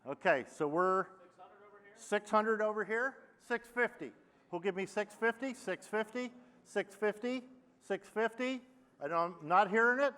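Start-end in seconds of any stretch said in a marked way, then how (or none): 2.0-8.6 auctioneer. Acted. Auctioneer bid calling.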